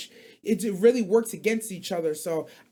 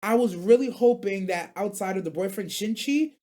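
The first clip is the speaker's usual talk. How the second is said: usual speaking voice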